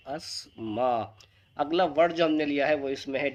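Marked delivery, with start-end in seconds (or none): 0.1-1.2 "अस्मा" said very slowly, th silent; drawn-out "अस्मा" for students to copy; slow model word. Shown as a demonstration. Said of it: "इसको आप ध्यान रखें". (धीरे-धीरे सिखाते हुए "अस्मा")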